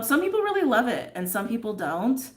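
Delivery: ordinary talk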